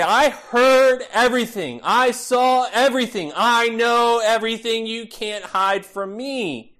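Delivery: mock-ominous all-knowing parent; triumphant parent. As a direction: relish catching the kids in a lie. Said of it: "the sweet satisfaction as a parent".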